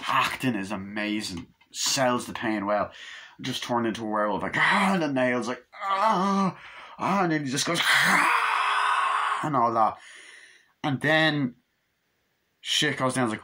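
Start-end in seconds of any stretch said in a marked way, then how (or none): 4.6-4.9 high, loud mock scream; imitated pain scream. Reviewer acting out turning into a werewolf, pained scream. 5.8-7.2 high drawn-out mock groan; imitated agonised cry. Reviewer acting out the transformation, agonised cry. 7.8-9.4 very high, long mock scream; imitated transformation scream. Reviewer acting out the werewolf change, long scream.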